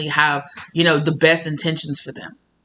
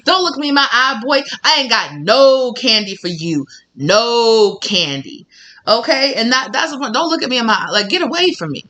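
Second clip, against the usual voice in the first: higher, haughty shoo-away; sassy shooing; 5 semitones higher than usual. Acted. Shooing someone off.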